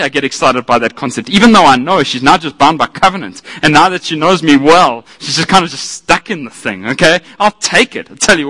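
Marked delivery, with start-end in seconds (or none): none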